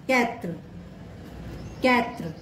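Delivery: quatre, short final r; French word demo. Shown as a demonstration. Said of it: "example". (कैत्र French "quatre" की तरह)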